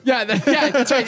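high put-on voice of the guy; mock agreement. Imitating the accused man, high and smug. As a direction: ham up his smug agreement.

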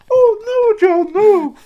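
very high, loud wailing Scottish plea; Scottish producer's anguish. Retold or imitated. Imitating his producer at the Trump interview, high wailing plea. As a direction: wail it like a horrified Scottish woman. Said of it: "And then".

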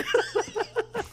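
high burst of real laughter. Real, not acted. Laughing at her own story with friends, high laugh. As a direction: crack up mid-story.

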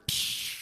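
sharp hiss of escaping air; deflating float noise. Retold or imitated. Friend retelling how the float popped in the water, imitated hiss.

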